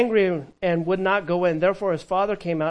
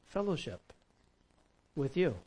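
ordinary talk, nothing marked

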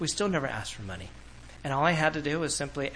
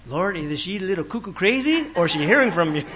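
laughing; 4 semitones higher, 5 dB louder than usual. Retold or imitated. Laughing retold inner prayer.

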